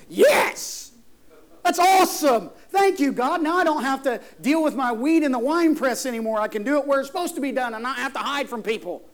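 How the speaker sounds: high, loud burst of relieved joy, easing off; acted elation. Preacher acting Gideon thanking God for good news, elated. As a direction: cheer it out, then settle into happy explaining. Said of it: "would have had a different reaction".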